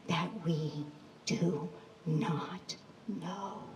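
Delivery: slow, grave whisper. Acted. Storyteller to the children, whispering the lesson. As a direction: breathe the words like a solemn secret.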